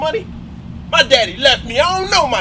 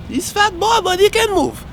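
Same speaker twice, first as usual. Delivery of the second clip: usual speaking voice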